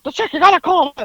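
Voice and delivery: high, agitated mock demand; Howard. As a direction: imitate a worked-up man pressing the clerk. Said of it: "Howard gets, you know".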